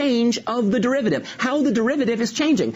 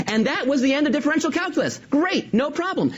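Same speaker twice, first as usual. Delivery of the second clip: chirpy triumph; 7 semitones higher than usual. Real, not acted. Cheerful wrap-up of a topic.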